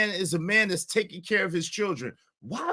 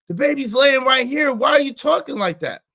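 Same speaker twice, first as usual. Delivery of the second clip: louder, higher exasperated plea; loud exasperated repeat; 7 semitones higher, 6 dB louder than usual. Retold or imitated. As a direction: push it up into an exasperated cry. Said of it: "She's being loud".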